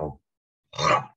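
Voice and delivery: ordinary talk, nothing marked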